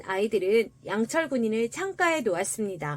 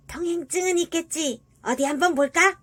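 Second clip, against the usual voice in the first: put-on rat voice demanding papers; sewer rat; 5 semitones higher than usual. A narrator's reading. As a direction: question them as a suspicious sewer rat. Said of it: "시궁쥐가 물었습니다". (캐묻는 시궁쥐 목소리로)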